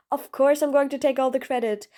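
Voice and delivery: ordinary talk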